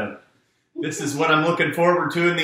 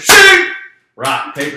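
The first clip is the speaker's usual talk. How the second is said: normal talking voice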